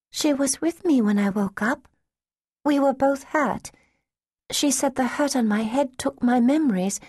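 put-on high, earnest child voice; a small girl explaining simply; polite little girl. Lost child telling the street girl about Miss Jane, high and earnest. Danny explains her past.

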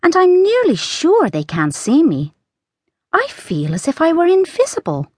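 put-on high, bright girl's voice; young girl Alice. A narrator's reading. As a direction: read as a curious young girl.

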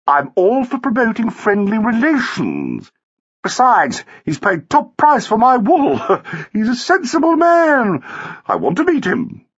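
high, emphatic society woman; gushing wife. A narrator's reading. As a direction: insist airily with a little laugh.

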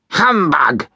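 high, sharp scornful bark; cross. Acted. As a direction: spit it out in disgust. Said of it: "said Scrooge crossly".

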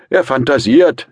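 put-on high, loud drunken yell; tipsy shout. A narrator's reading. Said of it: "schrie der angetrunkene Rasumichin".

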